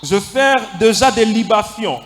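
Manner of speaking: building to preaching. Acted. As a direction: rise to a full preaching cry. Prédication montant en force. (prédication qui monte en puissance)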